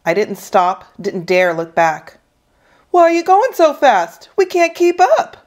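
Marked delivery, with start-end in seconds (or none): 2.9-5.4 high, loud jeering call; ugly taunt. Acted. Second bully chasing the kid, ugly and taunting. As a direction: jeer after them in a high voice. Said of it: "It was a different voice, just as ugly".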